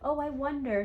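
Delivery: doubtful wondering. Retold or imitated. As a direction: sound unsure of yourself.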